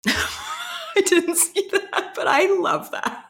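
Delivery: high, laughing delight. Real, not acted. Co-host reacts to the Daddy bracelet, laughing high. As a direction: squeal it through laughter.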